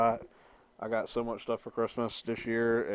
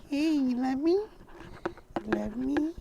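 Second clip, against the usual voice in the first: cooing to the cat; slower than usual. Real, not acted. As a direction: coo lovingly at the pet.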